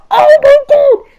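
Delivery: high, loud "Oh my God!" of shock; shocked cry. Retold or imitated. Kids on the wall seeing a friend thrown, shocked cry. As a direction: gasp it out at what you just saw.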